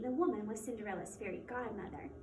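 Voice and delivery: ordinary delivery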